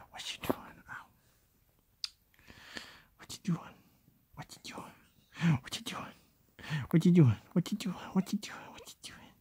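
hushed, half-whispered coo. Real, not acted. Cooing softly at the baby.

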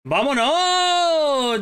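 long drawn-out high cheer; victory shout. Real, not acted. Gamer celebrating a won match, stretched victory shout. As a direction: let "¡Vámonos!" ring out slowly in triumph.

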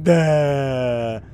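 lightly voiced dim creature; one long, dopey drone; mock dopey creature. Player voicing the creature's mouth, dopey. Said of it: "He's like".

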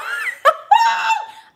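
high, loud burst of laughter; shrieking laugh. Real, not acted. Repeating that someone confessed a crush, shrieking laughter. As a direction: let out a shrill cackle of embarrassment.

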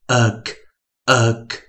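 uk as short u closed by a k; phonics demo. Shown as a demonstration. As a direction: uk three times, a clear gap after each. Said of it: "我们就发音为".

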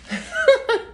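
sudden high laugh; high giggle. Real, not acted. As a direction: giggle mid-sentence. Laughs at own wording.

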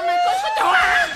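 very high, incredulous squeal; shocked shriek. Acted. Relative learning Út is pregnant, shrieking in shock. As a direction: shriek the question in stage shock.

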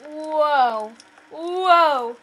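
loud startled gasp of surprise; jolted, shout it out; startled whoa. Real, not acted. Startled by jump scare.

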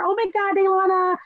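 put-on high, bubbly, gushing girlfriend voice. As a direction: gush over her like an overexcited friend. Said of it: "she's always really chipper".

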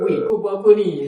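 hammed-up accusing phone call; retold call. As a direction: imitate yelling at a friend on the phone. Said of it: "saya terus call".

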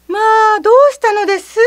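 high, quick startled neighbor's cry; alarmed neighbor. Acted. Neighbor rushing over at the child's loud crying, startled. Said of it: "と言って駆けつける".

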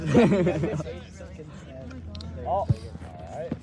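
long, soft laugh; helpless laugh at own joke; drawn-out chuckling. Real, not acted. Laughing at own top ten joke.

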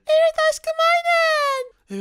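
put-on squeaky high-pitched spider girl, slow and sweet; girly high spider girl voice. (çok ince sesli örümcek kız)

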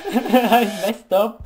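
laughing. Real, not acted. Laughs at own mistake.

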